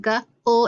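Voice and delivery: g as a lone "guh", then ul; sounds separated. Shown as a demonstration. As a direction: break g and ul apart before blending. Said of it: "it blends together and says gul".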